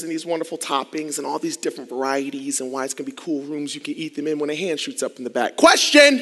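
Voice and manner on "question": loud, sharp shout of a heckler; called-out interruption. Retold or imitated. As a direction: blurt it from the back row. Classmate calls out mid-presentation.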